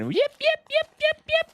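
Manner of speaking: high squeaky parrot yips; imitating parrot. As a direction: chirp it like an excited parrot. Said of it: "He's going".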